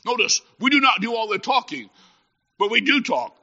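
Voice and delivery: ordinary talk, nothing marked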